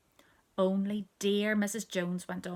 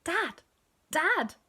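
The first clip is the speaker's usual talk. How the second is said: put-on high baby blurts; little toddler piping up; baby's new word; 9 semitones higher, 5 dB louder than usual. A narrator's reading. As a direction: pop each word out high and loud. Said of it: "So she said".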